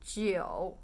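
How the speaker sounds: "Jiu" low and dipping; third tone. Shown as a demonstration. Teacher recalls word for beer, low third tone. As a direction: dip "Jiu" down low, drawn out. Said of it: "Third tone".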